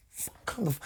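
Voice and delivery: whisper. Retold or imitated. Mid-show fight, hissing at bandmates.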